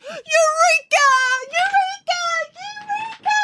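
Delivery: very high, drawn-out triumphant calls, fading; repeated high yells, trailing into the distance; yelling. A narrator's reading. Scientist runs naked through town, yelling.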